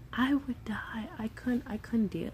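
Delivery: breathy whisper, words barely voiced; whispered despair. Real, not acted. Mother imagining losing her child, whispered despair. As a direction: let it fall to a stricken whisper.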